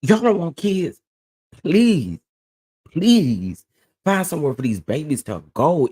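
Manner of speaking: high, heated scolding of abusive parents; real outrage. Real, not acted. Lecturing parents on the live stream, real angry outrage. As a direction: let the anger break out, pleading and scolding. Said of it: "I'm getting angry".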